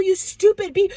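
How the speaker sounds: loud, high angry scolding; pissed-off accusation. Retold or imitated. Dreamer confronting the woman over deceiving people, angry shout. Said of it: "I was, like, pissed".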